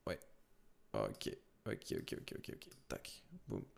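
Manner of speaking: very soft, barely voiced ok ok under his breath; muttered to himself. Real, not acted. Presenter checking his screen alone, soft muttering. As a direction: mutter quietly while sorting out the screen share.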